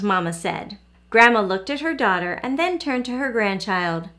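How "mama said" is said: ordinary delivery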